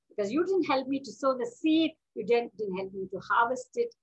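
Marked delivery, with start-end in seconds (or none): none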